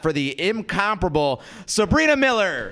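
rising MC build to the name; announcer introduction. Real, not acted. Host brings up next comic, big announcer introduction. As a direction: swell into the performer's name, high.

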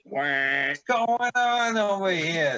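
high, slow goofy voice; silly clown voice. Pretend. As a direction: drawl it out like a clown. Silly voice riffing on scene.

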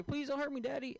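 high, pleading little voice; mock whimper to the judge. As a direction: beg the judge like a scared kid. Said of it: "and you say".

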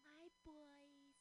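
high, slow, doting mom voice; drawn-out, high gushing praise; imitating Valerie. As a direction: coo it slowly like a mother gushing over her sons. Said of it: "impersonating their mom".

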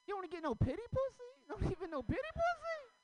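very high, loud, fast sassy voice; mock audience woman, pitched very high and fast; heckler voice. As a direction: squeal it back like the woman in the crowd. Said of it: "Someone was".

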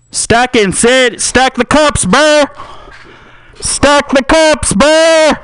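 high, loud, slow party yell. Acted. Partygoer yelling at friend to stack cups, loud. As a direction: bellow at your buddy across the table.

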